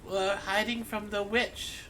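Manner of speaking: put-on high-pitched child voice; wood elf girl. Sloan explains hiding from the witch, high child voice. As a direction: confide it in a high girl's voice. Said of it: "says Sloan".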